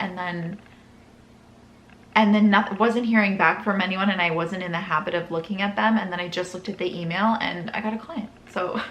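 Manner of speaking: normal talking voice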